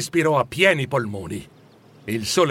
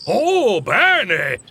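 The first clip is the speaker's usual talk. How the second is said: high, swooping cry of delight; exultant; 5 semitones higher, 4 dB louder than usual. A narrator's reading. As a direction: rejoice out loud. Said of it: "esultò Scrooge".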